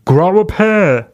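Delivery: blustery mock preacher, high and drawn out; Rodney Howard-Browne. Host mimicking the pastor's catchphrase, mock blustery. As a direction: puff it up as a blustering pastor impression. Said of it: "But this fucking blustery".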